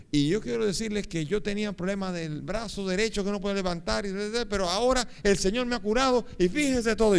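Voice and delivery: high, rising proclamation; excited testimony. Retold or imitated. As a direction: imitate a healed man telling the crowd. Healed man testifies excitedly to crowd.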